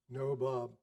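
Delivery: ordinary delivery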